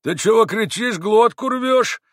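impolite, drawn-out jeering question; rude retort. A narrator's reading. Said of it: "с какой-то непонятную даже невежливостью".

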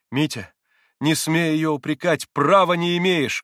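loud, high, indignant rebuke; heated shout. A narrator's reading. Alyosha rebukes his brother with a heated shout. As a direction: shout him down hotly. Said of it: "горячо крикнул на брата Алёша".

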